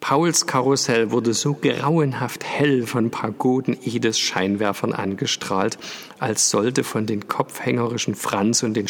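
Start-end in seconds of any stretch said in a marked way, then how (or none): none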